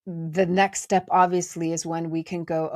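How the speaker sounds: ordinary talk, nothing marked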